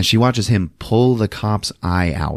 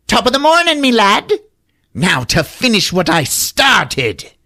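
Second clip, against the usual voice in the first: high, theatrical villain leprechaun; menacing leprechaun; 11 semitones higher, 3 dB louder than usual. Acted. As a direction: greet your victim as a pitched-up wicked leprechaun.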